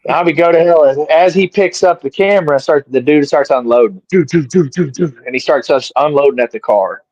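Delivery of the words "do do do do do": rapid string of mouthed shots; imitated gunfire. Man describing a shooter unloading, imitated gunfire. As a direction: mimic a gun emptying in even bursts.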